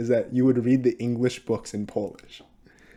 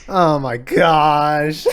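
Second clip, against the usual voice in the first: drawn-out laughing oh my gosh; 5 semitones higher, 9 dB louder, much slower than usual. Real, not acted. Friend laughing at the easy-win question, slow and laughing. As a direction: laugh through the words slowly.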